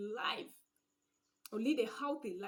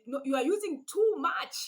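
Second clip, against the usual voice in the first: raised, sharp complaint at the wife, higher and louder; acted scolding spouse; 6 semitones higher, 8 dB louder than usual. Husband scolding wife over the meal, louder and higher. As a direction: snap at her over the spending.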